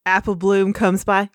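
ordinary delivery

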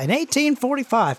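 high-pitched farmhand telling local history; Jimbo. Acted. Farmhand describing the land to hayride riders, high rustic voice. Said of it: "whose name is Jimbo. He's a farmhand".

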